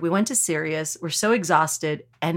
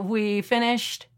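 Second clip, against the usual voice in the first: normal talking voice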